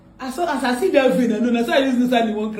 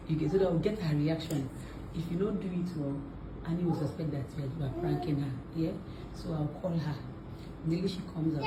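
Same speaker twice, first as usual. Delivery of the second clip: low, soft conspiratorial briefing; hushed plotting; 8 semitones lower, 12 dB quieter than usual. Real, not acted. Briefing an accomplice before calling the victim, hushed. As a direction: keep it down, plotting a prank.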